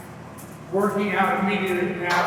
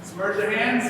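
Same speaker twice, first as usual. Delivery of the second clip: ordinary talk, nothing marked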